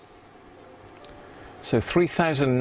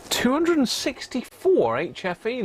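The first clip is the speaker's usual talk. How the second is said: excited; 11 semitones higher than usual. Real, not acted. Surprised by a high reading.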